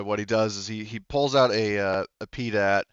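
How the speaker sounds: normal talking voice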